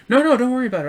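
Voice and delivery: quick, high "don't sweat it"; hurried reassurance. Retold or imitated. Customer waving off the driver's apology, reassuring.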